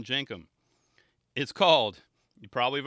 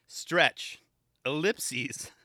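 ordinary talk

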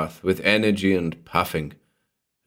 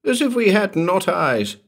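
"as if we had not eyes" echoed much higher, with force on "if"; sad but vigorous; 13 semitones higher, 3 dB louder than usual. Acted. Grocer echoing the tailor's scorn, sad but vigorous. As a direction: repeat it sadly yet with vigour, pitch up. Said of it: "said the grocer sadly, but with vigour".

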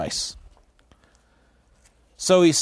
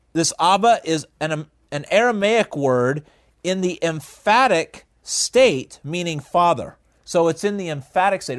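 normal talking voice

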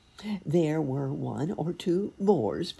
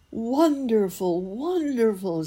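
mock delight; 9 semitones higher, 6 dB louder, slower than usual. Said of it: "said the cat".

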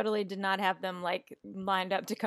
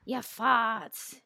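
movie line imitation; 3 semitones higher than usual. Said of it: "that is a line in the movie".

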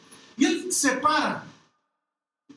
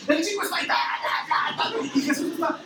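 ordinary delivery

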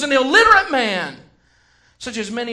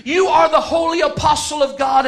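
spirit's call; 3 semitones higher, 6 dB louder than usual. Retold or imitated. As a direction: cry out his name from above. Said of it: "Suddenly the Spirit spoke again".

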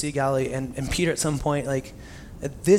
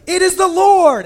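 much higher and louder, slowed exclamation; excited cry; 11 dB louder, slower than usual. Retold or imitated. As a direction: blurt it out in sudden recognition. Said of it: "And Peter's like".

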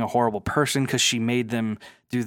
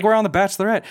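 indignant; 7 semitones higher, 5 dB louder, faster than usual. Retold or imitated. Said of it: "They're like".

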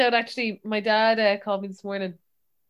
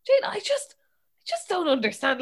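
high, helpless protest easing down; retold exasperation; 7 semitones higher than usual. Dad confused by apps, pleading with daughter, exasperated. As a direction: imitate a dad giving up in bafflement.

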